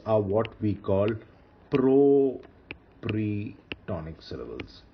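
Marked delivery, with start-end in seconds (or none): 1.7-4.8 slowly stretched out, piece by piece; slow term introduction. Shown as a demonstration. Teacher naming these two syllables for students, slow and drawn out. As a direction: draw out each part of the new term.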